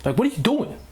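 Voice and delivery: irritated driver stuck in traffic; exasperated driver. Retold or imitated. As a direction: act fed up with the stalled drivers. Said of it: "I'm like".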